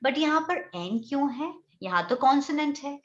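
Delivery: ordinary talk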